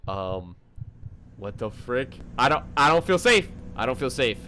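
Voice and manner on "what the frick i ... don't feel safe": ordinary delivery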